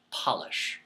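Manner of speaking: polish said with a short a sound like doll; clean-the-furniture polish. Shown as a demonstration. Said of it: "Two words, same spelling".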